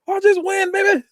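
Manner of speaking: high, loud strained athlete boast; Lewis. Retold or imitated. Quoting favorite scene's cocky player, high strained boast. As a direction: holler it high like a straining lifter. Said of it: "He goes".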